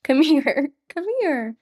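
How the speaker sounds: high, soft cooing. Real, not acted. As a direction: coo tenderly at the sleepy dog. Cooing at her dog.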